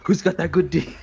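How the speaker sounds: hammed-up stud boasting in bed; mock cocky sex brag; mock macho brag. Quoting the guy mid-sex.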